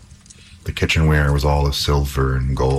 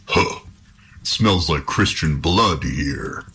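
troll; slower than usual. A narrator's reading.

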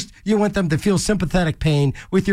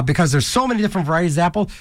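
usual speaking voice

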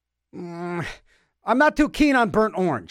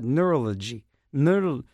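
usual speaking voice

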